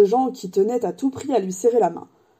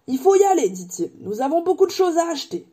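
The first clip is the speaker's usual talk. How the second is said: making oneself heard; 8 semitones higher than usual. A narrator's reading. Hagrid struggling to be heard in the bar, calling out. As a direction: raise your voice to cut through chatter. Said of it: "eut toutes les peines du monde à se faire entendre".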